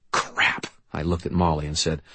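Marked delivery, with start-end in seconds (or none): none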